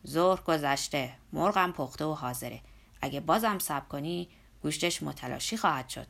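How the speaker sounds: put-on a hearty old worker nagging his boss; Zorba's voice.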